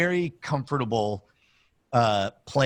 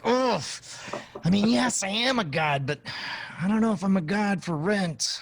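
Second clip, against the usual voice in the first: mock reluctant, pitched up high; flinching hesitation; 7 semitones higher than usual. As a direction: wince first, then hedge in a higher voice. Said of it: "you start with a flinch".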